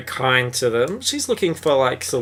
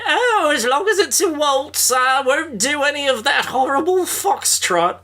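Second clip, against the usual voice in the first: put-on high, grand old-lady falsetto; lofty dowager disdain, pitched way up; haughty dowager. Dowager refusing a foxtrot at the servants' ball, haughty.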